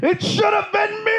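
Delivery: hammed-up, very high shriek; Jim Carrey. Comic as rival bursting out at the award winner, shrieking. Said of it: "Jim Carrey erupts from behind the flat".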